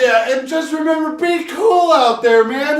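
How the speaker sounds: high, loud hammed-up surfer dude; laid-back dude. Cohost parodies a chill dude forecaster, high and loud. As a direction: play a chill beach guy hyping the heat.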